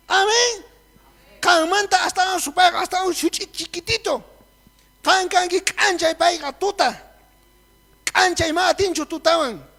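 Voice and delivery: loud, high fervent preaching; preaching at full force. Real, not acted. Preacher exhorting the church in Quechua, fervently loud. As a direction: preach it out with fire.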